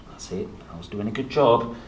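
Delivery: ordinary delivery, nothing marked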